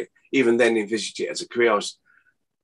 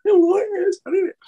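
very high squeaky mock voice; imitating eighties folk; 6 dB louder than usual. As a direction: send up how everyone talked back then. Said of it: "they all sounded like that".

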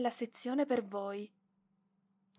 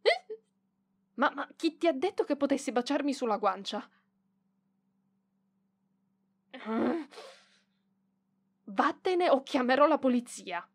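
flustered outrage; 3 semitones higher, 4 dB louder than usual. Acted. Librarian scolding the boy who kissed her cheek, flustered shout. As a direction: bristle at him like he crossed a line.

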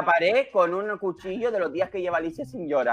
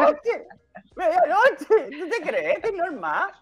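laughing exasperation; 10 semitones higher, slower than usual. Real, not acted. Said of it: "laughing exasperation".

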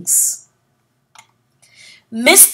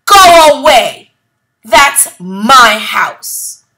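loud shout, slow on the last words; furious little man shouting; angry shout; 3 semitones higher, 9 dB louder, slower than usual. Acted. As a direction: yell at the ducks to leave his house. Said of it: "called the tiny Mister Greg".

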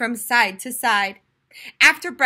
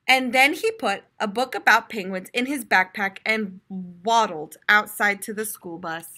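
ordinary delivery

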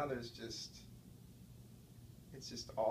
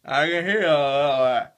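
talking loud with blocked ears; 15 dB louder than usual. Real, not acted. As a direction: speak up like your ears are plugged. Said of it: "You can't even hear me".

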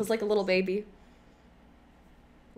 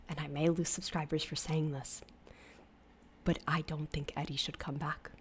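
very soft, careful admission; hushed confession; 3 semitones lower, 15 dB quieter than usual. Real, not acted. Vlogger admitting an unpopular opinion, hushed. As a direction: confess it almost under your breath.

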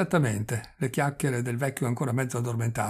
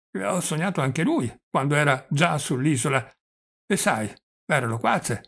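put-on stammering, chatty old prince; halting old man, catching on his consonants; doddering old prince; 2 semitones higher, 4 dB louder than usual. Old prince reminiscing to his nephew, doddering and stammering.

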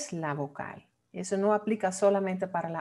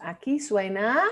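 ordinary delivery

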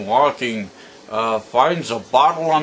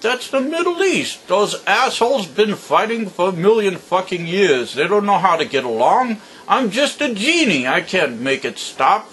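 indignant genie; 6 semitones higher than usual. Acted. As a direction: scold him like a fed-up genie. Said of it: "And the genie says".

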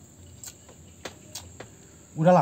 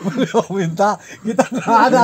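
higher, louder, laughing words; talking through laughter; 5 semitones higher, 6 dB louder than usual. Real, not acted. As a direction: push the words out while laughing. Bercanda sambil tertawa. (bicara sambil tertawa)

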